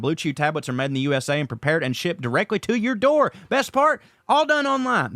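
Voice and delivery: upbeat podcast sponsor read. Acted. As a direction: sell it like a host-read ad. Host reads sponsor ad.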